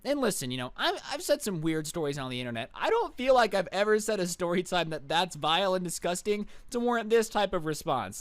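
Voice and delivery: ordinary talk, nothing marked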